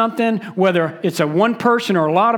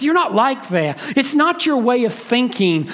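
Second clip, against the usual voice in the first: normal talking voice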